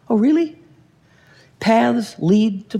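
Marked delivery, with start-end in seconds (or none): 0.1-0.6 higher, doubting oh really; sarcastic really. Real, not acted. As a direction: throw back a skeptical question. Mocking reply to excuse.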